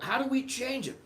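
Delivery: put-on high puzzled kid voice; light mock child asking for help. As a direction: pitch it way up like a clueless kid. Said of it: "And they're like".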